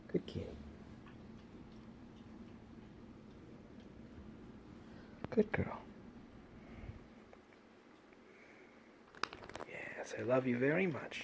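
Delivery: soft, quiet cooing. Real, not acted. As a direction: coo lovingly at the pet. Praising cat softly.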